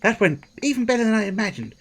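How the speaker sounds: high, quick, gleeful repeat; squeaky, hurried delight; delighted squeak. Real, not acted. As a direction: rush it out high-pitched.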